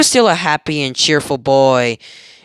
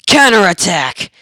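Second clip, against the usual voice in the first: battle cry; 4 semitones higher, 5 dB louder than usual. Acted. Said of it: "and yells out".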